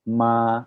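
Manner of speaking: "Ma" held long on one even pitch; first tone "Ma", steady and sustained; level tone on ma. Shown as a demonstration. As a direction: say "Ma" drawn out and level, no rise or fall. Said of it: "Kalau datar gini, dibacanya datar juga".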